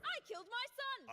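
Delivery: very high, anguished cry; anguished outburst. Acted. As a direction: confess in a high, desperate outburst. One-upping in an argument.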